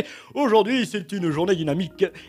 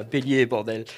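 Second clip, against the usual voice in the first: ordinary talk, nothing marked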